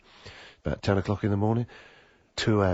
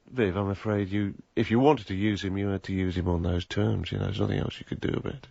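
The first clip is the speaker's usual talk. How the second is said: normal talking voice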